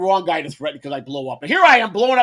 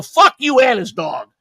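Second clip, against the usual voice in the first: defiant retort; 5 semitones higher, 6 dB louder, slower than usual. Retold or imitated. Telling off trolls.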